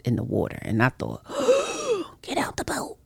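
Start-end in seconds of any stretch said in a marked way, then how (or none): 1.3-2.0 1 sharp gasp. Retold or imitated. Realization at art gallery. 2.2-3.0 1 awed whisper. Retold or imitated. Whispered revelation after gasp.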